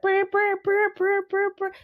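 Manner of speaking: rapid high-pitched shivering brr; jittery brr noise. Retold or imitated. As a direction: buzz your lips like a panicking nervous system. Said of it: "my nervous system is just like".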